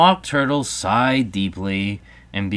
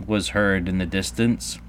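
ordinary delivery, nothing marked

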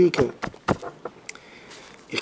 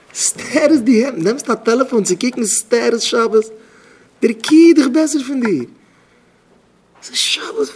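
high, raised-voice reproach; indignant scolding; 9 semitones higher, 5 dB louder, much slower than usual. Acted. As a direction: scold indignantly, pitched well up. Acted indignant scolding in a story. (אויפגעבראכטע מוסר)

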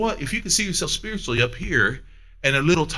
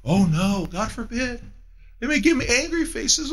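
hammed-up fretting, pitched up; sarcastic mock dismay; mock horror; 6 semitones higher than usual. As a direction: play the fuss for laughs. Mocking fear of disapproval.

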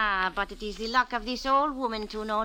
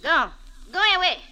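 sharp, loud and higher, shooing him away; angry dismissal; 5 semitones higher, 6 dB louder, faster than usual. Acted. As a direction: throws him out with a raised voice. Old woman chasing detective off.

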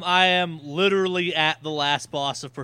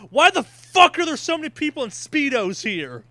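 baffled outburst; 8 semitones higher, 5 dB louder than usual. Retold or imitated. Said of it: "I'm walking around going".

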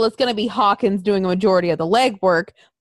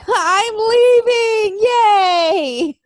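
"I'm leaving. Yay." much higher and louder, slow, half-crying with joy; gleeful cheer; 11 semitones higher, 6 dB louder, much slower than usual. Pretend. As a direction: squeal it like a happy escape. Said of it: "I'm sitting there going".

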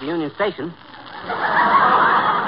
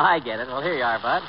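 normal talking voice

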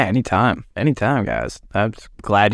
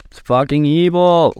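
louder, higher hammed-up evil line; mock outrage; 5 semitones higher, 5 dB louder than usual. Mocking mainstream media, louder hammed-up outrage. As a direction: ham it up like a melodrama villain.

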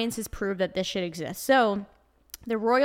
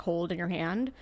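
ordinary delivery

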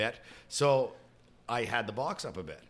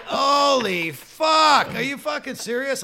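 high, slow, loud disbelieving shout; shocked yell; 13 semitones higher, 9 dB louder, much slower than usual. Retold or imitated. After ripping out a cable line, high shocked shout. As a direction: roar it in disbelief. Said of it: "So I'm like".

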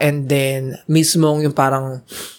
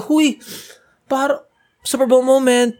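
thrilled inner voice; 8 semitones higher than usual. Retold or imitated. Recalling her thrilled inner thought.

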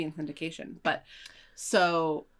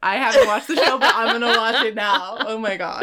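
laughing; 2 semitones higher, 7 dB louder than usual. Real, not acted. Gushing over an actor.

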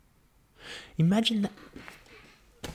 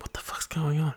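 a puzzled whisper, breathy and hushed; 5 semitones higher, 5 dB quieter than usual. Acted. First person ever pregnant, whispering in confusion. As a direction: whisper it to yourself, unsettled.

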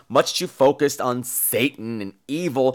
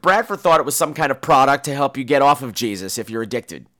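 ordinary talk

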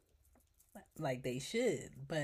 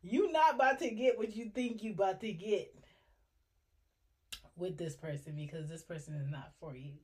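voice of God; 2 semitones higher, 3 dB louder, faster than usual. Retold or imitated. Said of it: "God is like".

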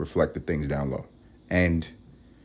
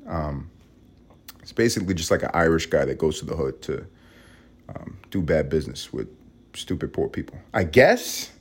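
usual speaking voice

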